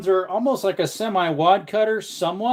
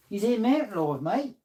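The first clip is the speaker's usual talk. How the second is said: higher-pitched mock Australian-style accent; put-on "mate" accent; 6 semitones higher than usual. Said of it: "my very different accents I do".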